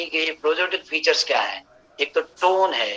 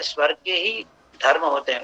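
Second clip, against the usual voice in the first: normal talking voice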